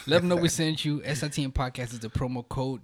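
upbeat promo read; sponsor ad read. Acted. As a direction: pitch it like an ad. Continues sponsor ad.

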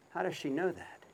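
ordinary talk, nothing marked

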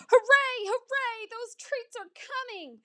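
high-pitched gleeful hooray; very high, giddy cheer; excited cheering. A narrator's reading. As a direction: squeal it like an overjoyed pup. Excited dog cheering treats.

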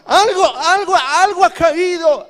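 high, full-force frantic shouting; Satanás in alarm. Acted. Devil warning his demons that power has descended, frantic shouting. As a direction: yell a warning over a noisy party. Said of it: "Satanás dice".

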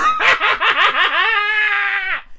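long, high-pitched laugh. Real, not acted. Comic after blaming Matthew Knowles, high drawn-out laugh. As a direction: crack up at your own joke.

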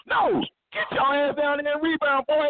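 high-pitched barking coach order; coach yelling. Retold or imitated. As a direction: chew the player out like a coach.

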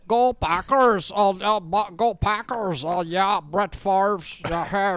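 puppet doing a Wisconsin fan; Wisconsin accent. Acted. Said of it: "perfect Wisconsin accent".

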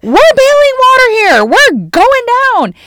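high, panicked shouting in a storm; yelling. Acted. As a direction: shout it frantically, stretching the words. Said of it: "like, this yelling thing".

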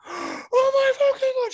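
very high, loud, slow shriek; drawn-out, high-pitched mock scream; imitated screaming. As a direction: mimic a horrified wife screaming. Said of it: "She starts screaming".

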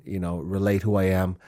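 ordinary talk, nothing marked

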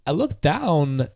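halting delivery with odd pauses; swooping, stop-start cadence; Christopher Walken. Acted. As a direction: speak in broken phrases, each peaking then dropping. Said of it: "That's, that's really good".

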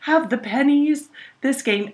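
lightly voiced excited kid; hopeful little girl. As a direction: play the eager daughter. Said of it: "And Junie was like".

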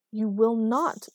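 loud, emphatic ban; stern refusal. A narrator's reading. Isabel forbids Erica sharing Marcus's room, firm and loud.

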